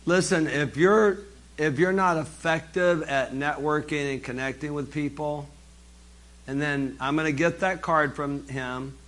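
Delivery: ordinary talk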